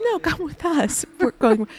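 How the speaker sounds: high, slow, insistent invitation; eager insisting woman. Retold or imitated. A woman at the bus stop insisting she come, high and slow.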